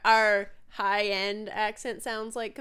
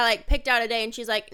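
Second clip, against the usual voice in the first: normal talking voice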